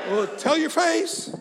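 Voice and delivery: high, loud, drawn-out challenge; rousing exhortation. Real, not acted. Preacher challenging the congregation about looking happy, rousing exhortation. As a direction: call it out slowly to the whole room.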